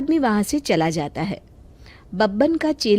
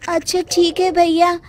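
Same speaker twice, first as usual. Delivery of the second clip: higher, louder woman's voice giving in; resigned village woman; 7 semitones higher, 4 dB louder than usual. Acted. Woman giving in to the mechanic's price, higher voiced and resigned. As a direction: agree reluctantly, a touch louder.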